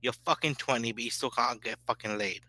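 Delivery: ordinary talk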